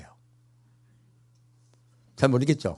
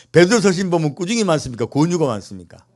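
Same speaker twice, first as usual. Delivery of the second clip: usual speaking voice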